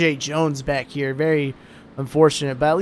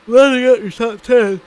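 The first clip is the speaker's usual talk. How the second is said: words stretched by a yawn; 5 semitones higher, 6 dB louder than usual. Real, not acted. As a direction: talk through a big yawn. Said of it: "That yawn is not from the racing".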